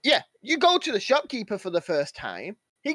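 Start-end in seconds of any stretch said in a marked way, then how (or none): none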